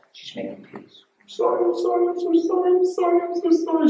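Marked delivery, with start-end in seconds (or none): 1.2-3.9 very high, loud crying squeal; imitated bawling. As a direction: wail the apology like a bawling wife, hammed-up. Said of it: "She's running to me bawling".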